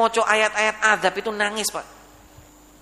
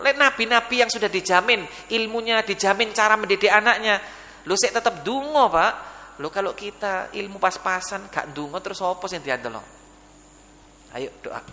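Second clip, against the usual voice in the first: ordinary talk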